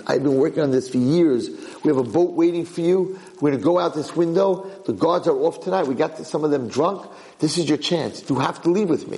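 acted insistent urging; urgent persuading. Old man urges escape.

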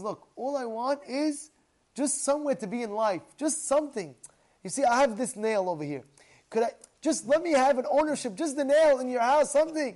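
high, loud acted plea; pleading beggar. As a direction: beg insistently for one small favour. Acts out man begging for a nail.

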